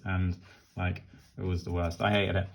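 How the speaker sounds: ordinary talk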